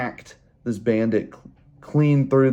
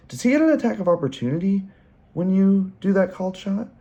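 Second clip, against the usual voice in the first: high, quick, uncertain question; higher questioning voice; 9 semitones higher, faster than usual. Retold or imitated. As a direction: ask it up high and fast. Player asks about opportunity attack.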